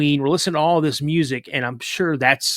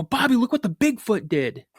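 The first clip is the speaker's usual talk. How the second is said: high-pitched, eager re-enacted call; excited kid calling; 10 semitones higher than usual. Kid telling little brother about Bigfoot, calling out excitedly. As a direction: imitate a kid showing off a big find.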